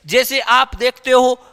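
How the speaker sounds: ordinary talk, nothing marked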